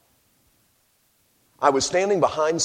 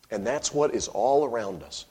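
ordinary delivery

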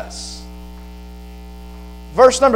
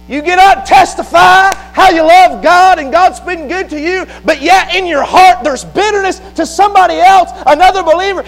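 high, forceful pulpit rebuke; full-force preaching; 9 semitones higher, 6 dB louder than usual. Real, not acted. Preacher confronting believers who testify yet hate, full-force preaching. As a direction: confront the hypocrite head-on in a raised preacher's cry.

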